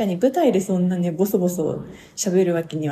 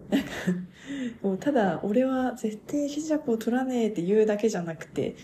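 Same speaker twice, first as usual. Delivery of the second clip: ordinary talk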